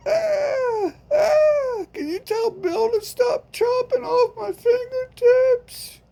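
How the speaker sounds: hammed-up sobbing through the pain; mock sobbing wail. Victim begging friend to stop the woman, fake sobbing.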